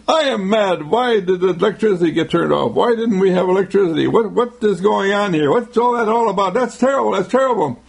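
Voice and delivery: imitated angry complaints. Residents berating the meter reader over the outage, mock angry. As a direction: pile up the complaints like indignant residents. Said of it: "beat up by them by saying".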